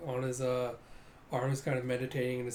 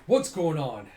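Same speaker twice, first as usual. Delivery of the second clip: raised-volume, baffled question; loud demand; 8 dB louder than usual. Acted. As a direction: call it out loudly. Character calls out in confusion.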